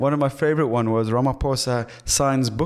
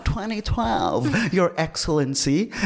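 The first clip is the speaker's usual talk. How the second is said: high-pitched, drawn-out American fan voice; mock American accent; 8 semitones higher than usual. Host mimicking Americans greeting the president, mock American accent. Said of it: "all these people are coming up to him and addressing him".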